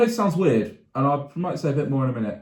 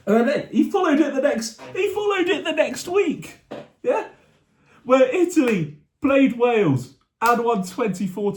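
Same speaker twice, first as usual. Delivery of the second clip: fan telling viewers about a game he attended, high-pitched and excited; high, giddy match-day excitement; excited recall; 9 semitones higher than usual. Real, not acted. Fan recounts seeing the Italian team in person, excited.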